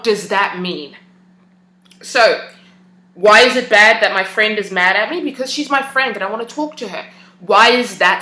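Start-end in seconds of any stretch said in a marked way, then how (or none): none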